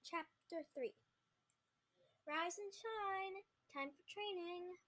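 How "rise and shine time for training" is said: high, slow, drawn-out wake-up call; high-pitched, leisurely morning summons. Acted. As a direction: rouse a sleeper, stretching the words. Waking a sleeping boy for training.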